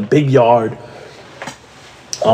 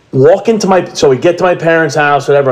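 ordinary talk

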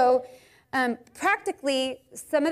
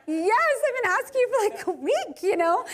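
exasperated wife; 9 semitones higher than usual. Retold or imitated. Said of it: "And I'm like".

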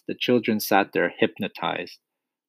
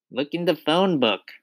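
put-on high-pitched boy's voice; Eric Ink; 7 semitones higher than usual. A narrator's reading. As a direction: answer back as a small pupil. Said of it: "Eric said".

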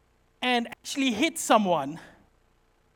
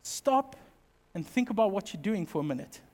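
soft, lower, calm voice; calm advice; 4 semitones lower, 6 dB quieter than usual. Retold or imitated. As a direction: quietly make him think. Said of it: "to go".

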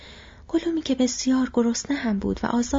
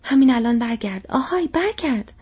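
loud shout, rising in pitch; 3 semitones higher, 6 dB louder than usual. A narrator's reading. Sam orders departing Gollum back, loud rising shout. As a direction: shout after someone who is leaving. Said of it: "سام فریاد زد".

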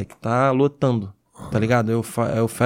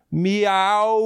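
kitten voice; 5 semitones higher than usual. Pretend. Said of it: "eu só falo".

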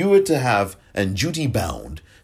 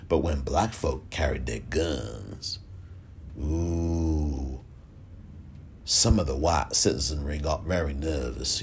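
slow, lower mock southern drawl; bad southern accent; 5 semitones lower, 6 dB quieter, slower than usual. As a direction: put on a horrible southern accent. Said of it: "That's a horrible accent because that's supposed to be a southern accent".

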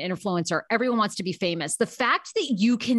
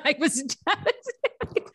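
laughing; 7 semitones higher, slower than usual. Real, not acted. Laughing while retelling rejection.

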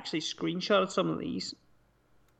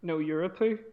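ordinary delivery

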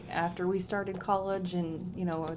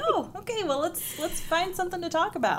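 imitating surprised self; 10 semitones higher than usual. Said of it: "I was like".

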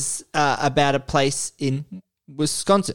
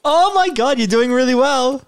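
amazed; 9 semitones higher than usual. Real, not acted. Host amazed by correct answers.

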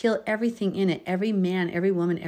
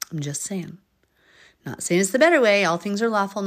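usual speaking voice

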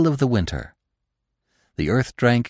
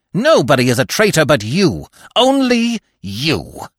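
high, loud, pointed blame; angry accusation; 6 semitones higher, 5 dB louder than usual. A narrator's reading. Fox singling out the dog, high bitter accusation. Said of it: "hissed the fox".

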